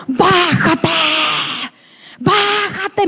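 long drawn-out shouted command; re-enacted furious yell; imitated angry yell. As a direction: yell it like an enraged man, stretching the first syllable. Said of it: "me gritaba así".

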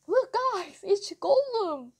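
high, taunting announcement; mocking excitement. A narrator's reading. Boy mocking a face to friends, excited jeer. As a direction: show him off to the gang.

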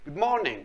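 "morning" with the R left silent. Shown as a demonstration. Teacher shows pupils the silent letter, R dropped. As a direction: say "morning" without sounding its R.

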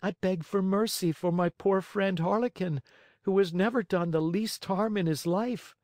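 high little puppet pleading; high-pitched childlike begging; Pinocchio begging. A narrator's reading. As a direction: plead earnestly in a small high voice.

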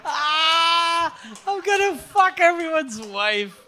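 high, strained mock battle cry; mock yelling. Co-host mimicking the friend's club outburst, mock yelling. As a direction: imitate a wild, drawn-out yell. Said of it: "He started yelling".

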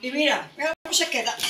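normal talking voice

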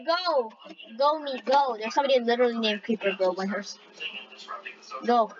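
normal talking voice

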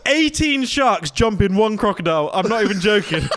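loud, high, emphatic claim; excited insistence. Real, not acted. Telling a disbelieving friend about sharks, loud excited insistence.

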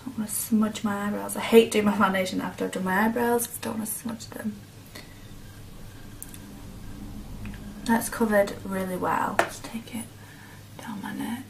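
murmured half-whisper while working; soft unvoiced aside. Real, not acted. Quiet aside while blending.

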